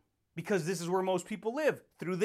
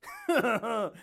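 big laugh; 3 semitones higher than usual. Real, not acted. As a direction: burst out laughing. Laughs before catchphrase.

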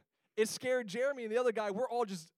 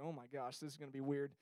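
much softer, lower nervous mutter; nervous dread; 6 semitones lower, 10 dB quieter, faster than usual. Retold or imitated. Scared teen approaching the creepy bridge, soft lower dread. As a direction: voice the dread under your breath. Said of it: "my nerves are starting to kick in".

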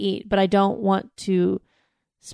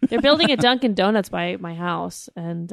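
normal talking voice

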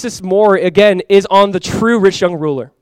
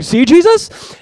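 high, louder mock-eager disciple; eager for affirmation; 11 semitones higher, 5 dB louder, much slower than usual. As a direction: play Peter fishing for approval, pitched right up. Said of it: "looking for affirmation".

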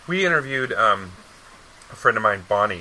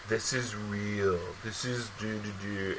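slow, soft, lower sing-song mockery; mocking parody; 3 semitones lower, 10 dB quieter, slower than usual. Mocking how reality shows claim to be real, slow and soft. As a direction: drone it out to ridicule the claim.

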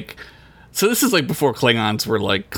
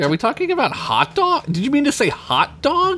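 mock confusion; 13 semitones higher than usual. Mock confused Spock questions.

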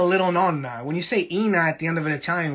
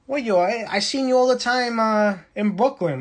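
Sicilian friend; 6 semitones higher than usual. Retold or imitated. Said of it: "He goes".

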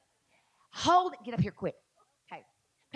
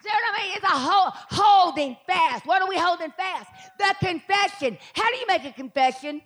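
fiery preaching; 7 semitones higher, 5 dB louder than usual. Real, not acted. Preacher rousing the congregation, high and loud. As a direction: preach it with fire.

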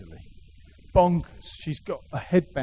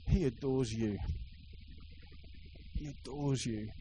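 1 soft repetition; 3 semitones lower, 9 dB quieter than usual. Real, not acted. Preacher repeats softly.

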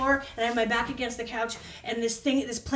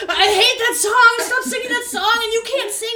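mock yell; 11 semitones higher, 9 dB louder than usual. Friends yelling at singer.

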